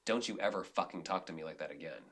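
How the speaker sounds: low, hushed, firm warning said off to the side; private warning. Retold or imitated. Director pulling a crew member aside, hushed and firm. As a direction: pull them aside and keep it quiet but firm. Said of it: "pull somebody aside and say".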